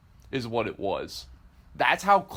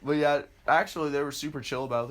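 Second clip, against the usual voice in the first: normal talking voice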